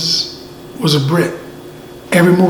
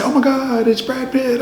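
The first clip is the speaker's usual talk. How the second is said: high, hammed-up fan amazement; mock starstruck; 9 semitones higher than usual. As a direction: pretend to gush at a star sighting. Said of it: "stop and say".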